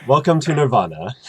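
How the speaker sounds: grand welcome. Acted.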